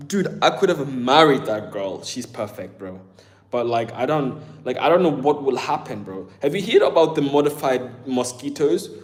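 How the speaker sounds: usual speaking voice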